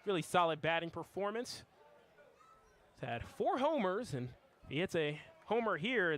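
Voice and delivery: ordinary delivery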